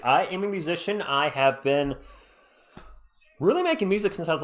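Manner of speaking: normal talking voice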